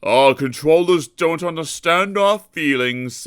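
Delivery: big engine making his point; emphatic Gordon. A narrator's reading. Gordon insisting to Sir Handel that controllers don't care. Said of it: "emphasized Gordon".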